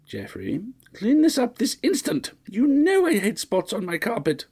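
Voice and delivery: very high, scolding matron; shrill old lady. A narrator's reading. Fussy mistress nagging her butler, high and shrill.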